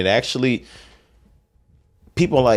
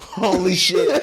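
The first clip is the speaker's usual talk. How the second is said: high, slow laughing exclamation; 12 semitones higher, much slower than usual. Real, not acted. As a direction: laugh through it in disbelief. Reacting to Joe Rogan joke.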